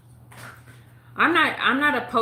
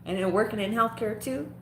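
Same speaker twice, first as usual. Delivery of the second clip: usual speaking voice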